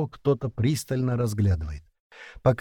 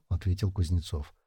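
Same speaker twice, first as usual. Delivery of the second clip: plain reading voice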